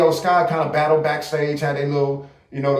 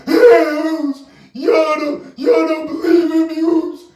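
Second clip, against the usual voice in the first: high-pitched whining mock wail, much higher and louder; mock crying Jey Uso; 12 semitones higher, 3 dB louder than usual. Streamer mocks the wrestler's crying promos, high mock wail. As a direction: whine it like a crybaby on the mic. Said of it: "he up there crying".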